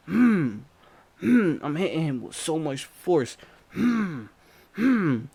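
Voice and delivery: high, loud grunts; effort grunts. Retold or imitated. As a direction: grunt with each blow. Grunting while hitting him.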